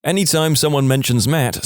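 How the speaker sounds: usual speaking voice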